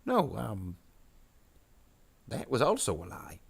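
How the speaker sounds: swooping little grot voice; grot minion. Acted. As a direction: squirm out of it as a grot. Grot argues with its boss.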